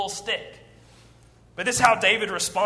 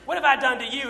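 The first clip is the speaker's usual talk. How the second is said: raised, indignant retort; David snapping at his brother, mildly indignant; angry protest; 6 semitones higher, 4 dB louder than usual. Retold or imitated. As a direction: snap back at the big brother. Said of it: "David gets angry and says".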